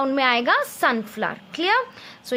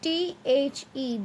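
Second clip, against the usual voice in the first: put-on slow, letter-by-letter spelling for children; spelled out slowly; 5 dB quieter, slower than usual. Shown as a demonstration. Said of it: "in this cloud we will write the".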